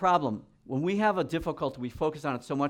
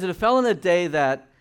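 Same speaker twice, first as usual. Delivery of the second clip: scripture reading; 3 semitones higher, 6 dB louder than usual. Acted.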